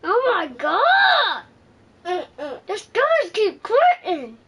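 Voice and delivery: crying and shouting in frustration. Real, not acted. Child upset at video game.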